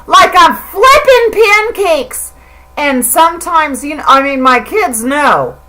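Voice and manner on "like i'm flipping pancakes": mock swearing. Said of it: "So now I'm like".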